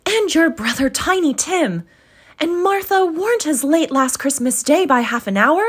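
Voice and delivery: a busy mother fussing over the late ones; Mrs Cratchit's voice. Acted.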